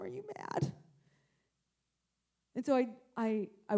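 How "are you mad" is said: put-on small high, soft child's question; meek little voice asking Mom; timid child. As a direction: imitate a scared kid, higher and softer.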